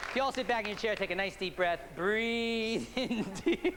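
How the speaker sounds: slow soothing hypnotist voice; hypnotic induction. Acted. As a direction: lull them into trance.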